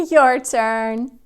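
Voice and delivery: ordinary talk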